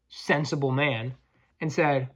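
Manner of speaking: ordinary delivery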